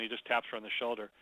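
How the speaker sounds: ordinary talk, nothing marked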